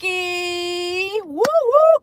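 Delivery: high, drawn-out Kentucky then loud woo; rally the chat like a hype crowd; cheer. Shown as a demonstration. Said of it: "Everyone say Kentucky".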